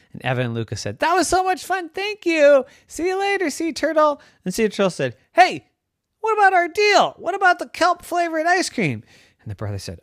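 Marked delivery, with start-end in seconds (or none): 1.0-9.0 high, loud call; excited calling out. Acted. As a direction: shout after them. Goodbye then turtle demands deal.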